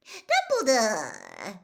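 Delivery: drawn-out, loud delighted cry; delighted greeting. Acted. Rita greeting Dumbledore with exaggerated delight, gushing cry. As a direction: cry out his name in showy delight. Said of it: "with every appearance of delight".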